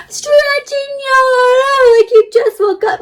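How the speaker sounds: very slow, high, sung-out yawn; big stretching yawn. Shown as a demonstration. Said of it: "Can you give me a big".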